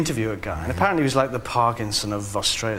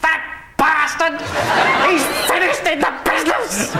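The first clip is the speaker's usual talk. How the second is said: high, loud ranting through laughter; mock furious shout; 5 dB louder than usual. Host raging over the intercom about Kenny, mock furious. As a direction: rant like an outraged host. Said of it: "heard him on the intercom saying".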